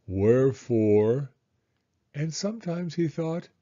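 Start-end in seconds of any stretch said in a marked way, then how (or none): 0.1-1.3 gloomy donkey. Acted. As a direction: drag the word out like a glum old donkey. Eeyore voiced gloomily.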